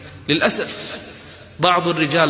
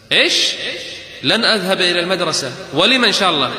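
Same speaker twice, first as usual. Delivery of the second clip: outraged father; 4 semitones higher than usual. Retold or imitated. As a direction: hurl the question back at her. Angry father shouts at daughter. (الأب يصرخ مستنكرا بغضب)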